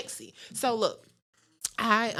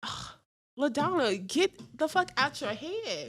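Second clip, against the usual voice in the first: self scolding; 6 semitones higher than usual. Retold or imitated. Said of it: "I was like".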